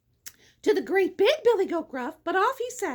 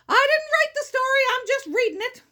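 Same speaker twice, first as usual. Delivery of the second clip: higher, louder self-defence; defensive protest; 7 semitones higher, 5 dB louder than usual. Real, not acted. Reader excusing the odd wording of the story, defensive protest. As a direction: disclaim the story with a loud protest.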